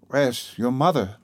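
slow, faltering question. A narrator's reading. Old father asking his daughter about her mother, faltering. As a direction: ask it afraid of the answer. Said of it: "he put it with a faltering voice".

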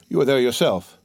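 ordinary delivery, nothing marked

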